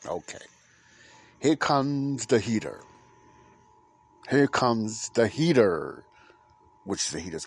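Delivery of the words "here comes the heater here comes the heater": announcing call. Real, not acted. Announcing the heater.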